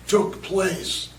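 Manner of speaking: usual speaking voice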